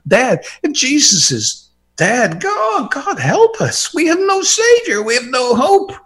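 high, pleading outcry; anguished cry. Acted. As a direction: cry out to God in despair. Anguished cry if Christ is dead.

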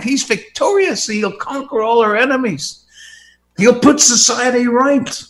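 high, loud exultant preaching; triumphant preacher proclaiming loudly; full-force preaching. Acted. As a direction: proclaim the victory over death to the hall.

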